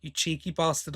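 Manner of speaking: ordinary delivery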